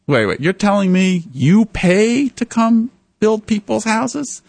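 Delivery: can't believe what he hears; retold stunned stranger; astonished disbelief. Guy stunned that volunteers pay.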